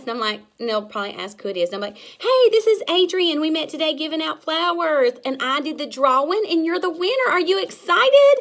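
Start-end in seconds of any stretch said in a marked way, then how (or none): 2.0-8.3 much higher and louder, bursting with good news; bubbly prize call; excited winner announcement. Shown as a demonstration. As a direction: tell her she won like it's a party. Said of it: "This is what it's gonna be".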